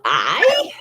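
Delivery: put-on little-boy answer sliding upwards; wee boy imitation. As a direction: mimic a small child with a rising swoop. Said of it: "the wee man started saying".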